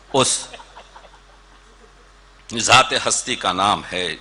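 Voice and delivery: ordinary talk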